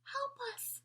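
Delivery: high, thin plea from a hidden doll; little high voice pleading; tiny cry for help. A narrator's reading. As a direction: beg for rescue in a tiny voice. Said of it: "cried another voice".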